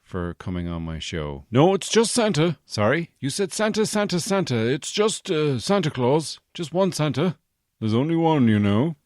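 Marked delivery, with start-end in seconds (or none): 1.5-9.0 booming jolly Santa; big loud Santa voice. Acted. Comedian playing Santa, booming.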